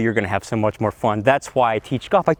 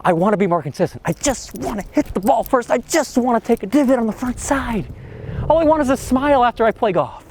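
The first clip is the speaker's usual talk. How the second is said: lightly voiced eager golfer, higher; the hopeful student's wish list, pitched up; 1 quoting typical golfers; 8 semitones higher than usual. Golf coach voicing what every student says, higher and eager. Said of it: "everybody says".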